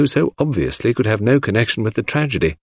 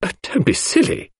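much higher, sharp dismissive retort; with asperity; 12 semitones higher than usual. A narrator's reading. Cynthia rebuffing the proposal sharply, much higher. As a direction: brush him off tartly. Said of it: "with some asperity".

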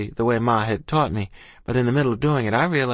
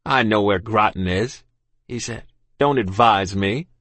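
stern captain; 3 dB louder than usual. Acted. Captain rebukes the girl.

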